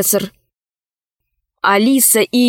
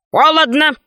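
dog's one-word utterance, slightly raised; talking dog; 4 semitones higher than usual. A narrator's reading. A dog answers the professor with its one word, dog voice.

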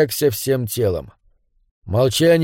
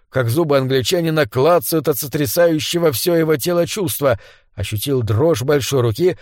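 ordinary delivery, nothing marked

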